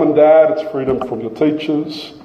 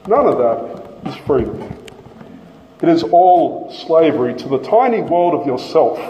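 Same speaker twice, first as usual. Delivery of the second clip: ordinary talk, nothing marked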